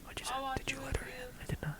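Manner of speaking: very soft whispered banter. Acted. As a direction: hush it like a secret.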